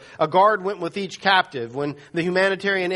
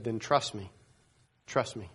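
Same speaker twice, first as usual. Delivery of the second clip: hushed, much softer urging; quiet reassurance; 5 semitones lower, 7 dB quieter than usual. Retold or imitated. Undercover rescuer to Keith, softly and lower: quiet, urgent. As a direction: lower and softer, pressing him to trust.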